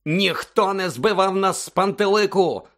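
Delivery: slow, high indignant shout; yelling. A narrator's reading. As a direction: bellow from the bed in protest. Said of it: "зарепетував зі свого ліжка Рон".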